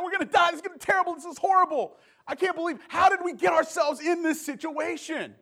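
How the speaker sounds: high, loud panicked shouting; panicked young guy. Retold or imitated. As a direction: freak out like you're about to die. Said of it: "he's just freaking out".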